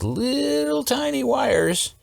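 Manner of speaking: high, drawn-out playful squeak; tiny voice. Pretend. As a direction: stretch it up high as if showing how tiny. Tiny voice for tiny wires.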